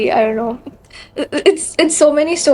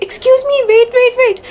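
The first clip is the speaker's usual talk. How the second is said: urgent calling out; 9 semitones higher than usual. Retold or imitated. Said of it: "He was like".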